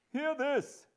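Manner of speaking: high, loud cry of despair; helpless exclamation. Acted. Husband exclaiming to the elements, helpless. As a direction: appeal loudly to the heavens. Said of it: "he helplessly exclaimed to the elements".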